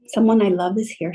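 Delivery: ordinary talk, nothing marked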